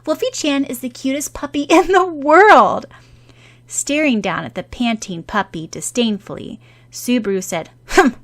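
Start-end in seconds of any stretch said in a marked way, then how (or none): 0.1-2.8 indignant. Acted. Defending the puppy indignantly. 7.9-8.2 disdainful humph. Acted. Disdainful scoff.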